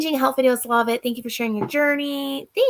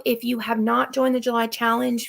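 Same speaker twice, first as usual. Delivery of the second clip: ordinary talk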